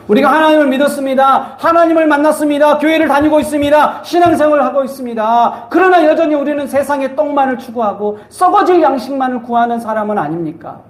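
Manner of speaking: high, driving sermon cadence; fervent preaching. Real, not acted. Preaches challenge to congregation. (높은 음으로 몰아치듯 열띤 설교)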